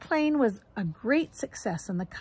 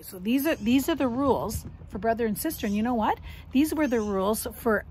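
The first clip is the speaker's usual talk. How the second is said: ordinary delivery, nothing marked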